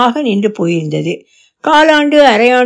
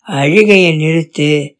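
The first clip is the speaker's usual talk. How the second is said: stern father's order; 7 semitones lower than usual. Acted. Father orders her to stop.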